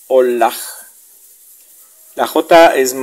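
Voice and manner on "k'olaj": olaj opening with a popped k, then a catch before o. Shown as a demonstration. Teacher models K with apostrophe, olaj with popped onset. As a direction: click the k, stop, then say olaj. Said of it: "el sonido K con apóstrofo".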